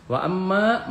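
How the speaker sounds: "Wa amma" with a long hummed mm; gunnah recitation. Shown as a demonstration. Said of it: "gunnah dibaca dengung".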